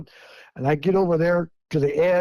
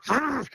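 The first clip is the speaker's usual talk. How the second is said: imitated playful growl; 8 semitones higher, 4 dB quieter than usual. Sasquatch toddler shaking a bush at him, imitated growl. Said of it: "and he goes".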